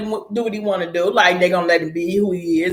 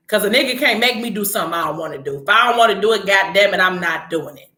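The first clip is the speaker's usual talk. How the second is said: ordinary talk, nothing marked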